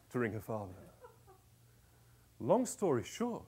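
usual speaking voice

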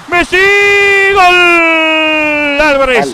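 high, loud goal yell; goal shout. Real, not acted.

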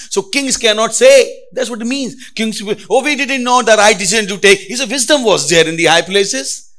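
loud, high preaching. Acted. Preacher pressing the congregation, loud and high. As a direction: drive the point home to the crowd.

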